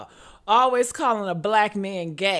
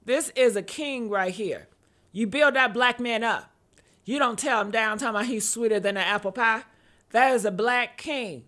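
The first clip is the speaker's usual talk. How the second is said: ordinary talk, nothing marked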